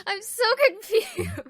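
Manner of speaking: real laugh of confusion. Real, not acted. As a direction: burst out laughing as you admit it.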